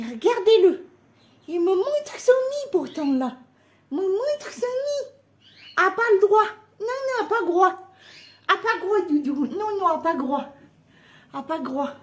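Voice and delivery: high, fond scolding of a hen; high baby talk to a pet. Acted. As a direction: coo and tut at a naughty hen.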